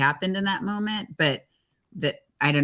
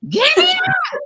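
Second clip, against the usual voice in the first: imitating her shrieking at the top of her voice, much higher; hammed-up panicked scream; full shout; 7 dB louder, slower than usual. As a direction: scream it like you're dangling upside down. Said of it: "you hear her yell".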